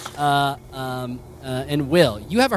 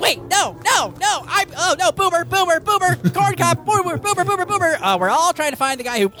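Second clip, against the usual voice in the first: much higher, frantic babble; panicked squeaky creature. Acted. Captured creature begging as the heroes grab it, high panicked squeal.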